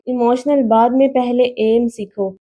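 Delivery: ordinary delivery, nothing marked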